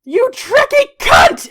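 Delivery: high, loud, drawn-out scream of rage; furious monster shriek. Acted. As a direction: scream it slowly at the enemy. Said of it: "he is going to scream".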